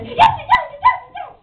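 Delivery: high, loud, eager outburst; excited cutesy character. Acted. Honey answers excitedly.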